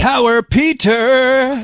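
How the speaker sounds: slow, stretched heroic proclamation; grand announcer. Acted. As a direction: announce it like a wrestling champion. Hailing the leader's new nickname.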